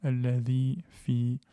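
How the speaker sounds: Alladhi fi with the long vowels held; slow recitation. Shown as a demonstration. Teacher demonstrating the same lengthening in Alladhi fi. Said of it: "le même allongement".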